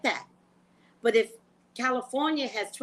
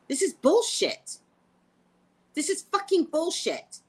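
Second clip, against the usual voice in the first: indignant rant; 7 semitones higher, 3 dB louder than usual. Real, not acted. Ranting about taxes.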